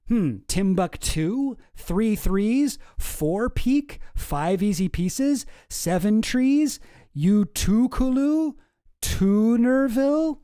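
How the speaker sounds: slow, rising list like a man straining to recall; pondering guy. Acted. Drunk third guy listing camp names.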